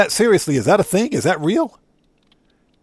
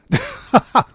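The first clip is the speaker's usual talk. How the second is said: sudden high-pitched laugh; real shocked laugh; high burst of laughter; 4 dB louder than usual. Real, not acted. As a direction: crack up in a squeal.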